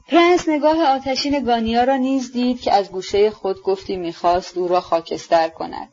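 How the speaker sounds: ordinary delivery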